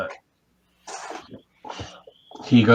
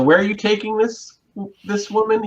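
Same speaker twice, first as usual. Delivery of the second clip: higher-pitched officer, pointed question; 8 semitones higher than usual. Acted. Officer questioning the party about the woman, raised pitch. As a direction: demand to know where she's going.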